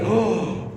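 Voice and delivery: exaggerated soft gasp of surprise; a soft, put-on intake of breath; mock gasp. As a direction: fake a shocked little gasp. Mock God reacting, surprised.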